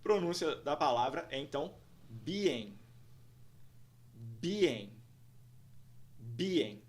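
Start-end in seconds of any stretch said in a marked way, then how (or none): 2.1-6.8 "being" with first syllable raised and lengthened; slow teacher's model of "being" in two syllables; first syllable stressed. Shown as a demonstration. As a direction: draw out BEE in "being", then drop on -ing. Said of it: "em um tom mais alto e alongá-la".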